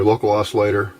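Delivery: ordinary delivery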